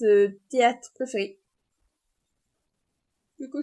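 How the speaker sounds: ordinary talk, nothing marked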